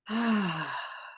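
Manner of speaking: slow audible breath out; long exhale sigh. Shown as a demonstration. Breathing exercise demo.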